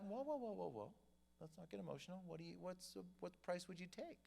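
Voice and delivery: soft, soothing negotiator voice; calming down. Retold or imitated. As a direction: quietly talk the upset seller down. Buyer soothes an upset seller.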